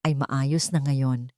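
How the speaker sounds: ordinary delivery, nothing marked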